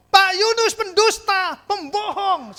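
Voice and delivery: high, loud, slow accusation; a hint of a weeping widow accusing; mock angry wail. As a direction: voice her furious tears. Voicing the crying accusing woman.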